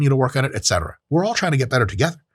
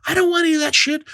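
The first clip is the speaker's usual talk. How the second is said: mock partner voice. Voicing partner's frustration.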